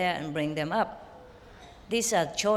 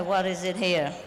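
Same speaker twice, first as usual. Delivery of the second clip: normal talking voice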